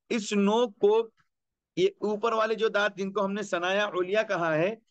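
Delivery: ordinary talk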